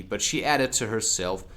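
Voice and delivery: ordinary delivery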